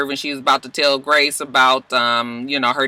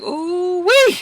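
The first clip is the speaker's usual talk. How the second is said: very high, slow gloating exclamation; hammed-up relief; 13 semitones higher, 4 dB louder, slower than usual. Lady Mae sees her secret is safe, hammed-up thankful relief.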